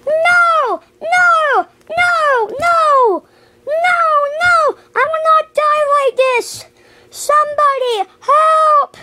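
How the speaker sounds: high, drawn-out mock death wails; acted desperate cries. Doomed character calling for help, high hammed-up panic. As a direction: wail for rescue in a high voice.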